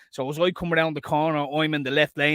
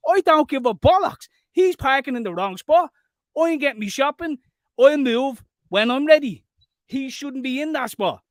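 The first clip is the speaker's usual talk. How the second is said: mock rant; 8 semitones higher, 4 dB louder than usual. Voicing shouting at guard.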